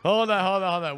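ordinary delivery

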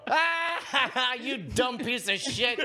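cackling insult. Retold or imitated. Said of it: "you started cackling and go".

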